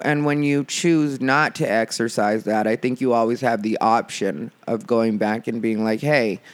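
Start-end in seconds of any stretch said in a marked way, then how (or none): none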